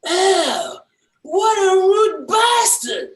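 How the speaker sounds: high, loud, drawn-out mock outrage; wife's outraged shriek. Wife's first thought at husband's reply, hammed-up shriek. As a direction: screech it slowly as the offended wife. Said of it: "first thought that goes through her head".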